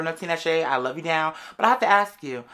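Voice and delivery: ordinary talk, nothing marked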